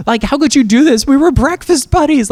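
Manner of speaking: hammed-up outrage at a betrayal; mock outrage. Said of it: "we were so angry".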